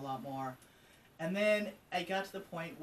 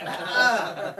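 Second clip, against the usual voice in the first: much louder, higher awkward laughing ah; mock awkward laugh; 8 semitones higher, 14 dB louder than usual. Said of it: "I'm like".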